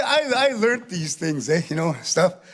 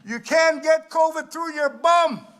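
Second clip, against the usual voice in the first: higher, slow half-shouted scolding; mock yell at shoppers; 8 semitones higher, slower than usual. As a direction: yell at hoarders to put it back.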